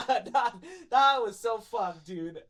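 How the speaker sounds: loud, slowed words through laughter; real amused exasperation; laughing disbelief. Real, not acted. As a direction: laugh while protesting how bad it was. Laughing over the bad experience.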